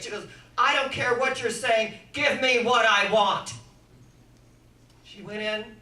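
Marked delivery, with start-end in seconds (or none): 0.6-3.5 loud, forceful refusal to take no; patient pushing back hard at her doctor, firm and loud; insistent demand. Retold or imitated. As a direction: put your foot down with the doctor.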